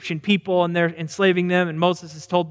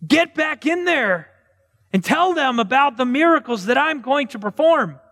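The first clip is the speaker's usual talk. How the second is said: a bragging God scolding Moses to get back in; exasperated God; 7 semitones higher than usual. Acted. Preacher voicing God telling Moses to get back in, high and incredulous. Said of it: "And God said".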